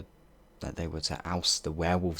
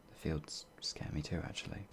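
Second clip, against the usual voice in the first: a timid whisper; hushed, scared admission; whispered confession; 3 semitones lower, 10 dB quieter than usual. Acted. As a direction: breathe it out as a secret. Confessing her fears.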